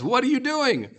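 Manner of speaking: high, sassy demand; indignant high-pitched question; with attitude. Shown as a demonstration. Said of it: "with some attitude".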